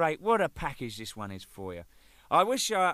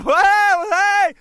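loud, high repeated hey, hey in a different voice; high shouting hey, hey, hey, hey; retold shout; 15 dB louder, much slower than usual. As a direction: keep yelling to get his attention. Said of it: "He's gone".

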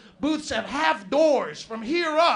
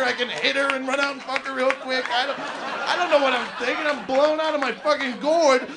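ordinary delivery

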